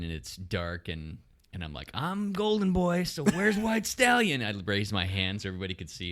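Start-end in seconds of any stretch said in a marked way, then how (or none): 1.9-4.4 high, stretched-out shout to a crowd; retold calling out. Racer calling out to rivals on the dark start line, retold high call. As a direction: call it out slowly, pitched way up.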